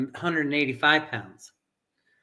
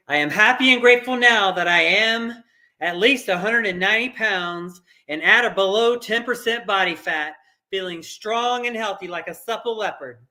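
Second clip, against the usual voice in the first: with feeling and power; 5 semitones higher than usual. Shown as a demonstration. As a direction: declare it like a prayer you fully believe. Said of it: "I say it with feeling, with power".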